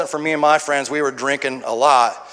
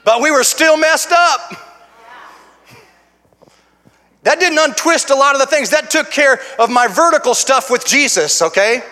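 raised, emphatic testimony building in force; forceful preaching; 7 semitones higher, 4 dB louder than usual. Real, not acted. Preacher testifies to being saved and set free, forceful and emphatic. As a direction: drive each statement home to the congregation.

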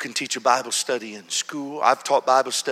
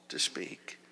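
whisper; 8 semitones lower, 13 dB quieter than usual. Retold or imitated. Preacher whispers in quiet story.

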